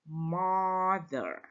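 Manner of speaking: mother drawn out with an open "ah" vowel; correct pronunciation of mother. Shown as a demonstration. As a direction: stretch mother as a clear model, falling at the end. (pengucapan mother yang benar, "a" panjang)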